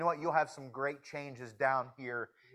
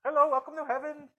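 put-on jokey Indian accent, higher and quick; mock Indian accent; 11 semitones higher, 4 dB louder, faster than usual. Preacher teasing his friends about heaven, mock Indian accent. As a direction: tease the guys with a quick, high Indian-accent greeting. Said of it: "an Indian accent".

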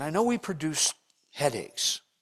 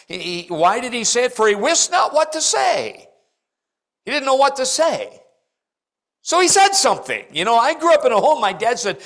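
loud high-pitched preacher's drive; preaching at full force; 10 semitones higher, 8 dB louder than usual. Acted. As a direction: hammer it home to the congregation.

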